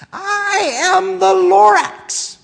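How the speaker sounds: slow, high-pitched booming shout; high shouting Lorax. Acted. Lorax confronting the Onceler, slow high shout. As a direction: announce yourself as the angry Lorax. Lorax announces himself in story.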